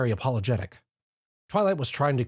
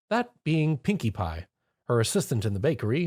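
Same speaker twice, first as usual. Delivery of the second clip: ordinary delivery, nothing marked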